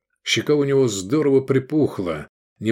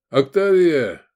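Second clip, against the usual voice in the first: raised, louder call by name; 6 semitones higher, 5 dB louder, slower than usual. A narrator's reading. Sergei calling Octavia to pack food, raised call. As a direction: call her over.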